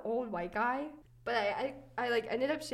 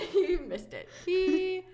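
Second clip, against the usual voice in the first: laughing, higher and louder; 5 semitones higher, 7 dB louder than usual. Real, not acted. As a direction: crack up at the memory. Laughing about the missed leg.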